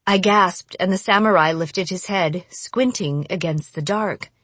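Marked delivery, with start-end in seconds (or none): none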